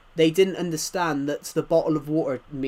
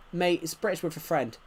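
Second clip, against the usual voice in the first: usual speaking voice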